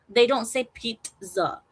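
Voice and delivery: ordinary delivery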